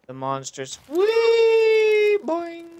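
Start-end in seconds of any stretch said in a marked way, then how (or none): 0.9-2.2 high, loud joyful squeal; gleeful whee. Real, not acted. Character jumps. 2.2-2.7 playful boing. Pretend. Imitating a bounce.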